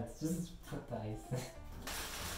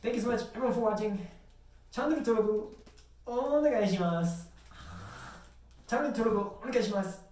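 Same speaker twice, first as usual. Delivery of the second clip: fast outro; 6 semitones higher than usual. Real, not acted. Fast rushed outro sign-off.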